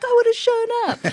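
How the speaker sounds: high mock-indignant insistence; imitating indignant husband. Wife mimicking her husband's protest, high and indignant.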